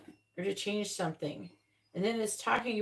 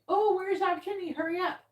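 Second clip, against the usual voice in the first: impatient whine; 10 semitones higher than usual. Retold or imitated. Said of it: "You're in that energy again".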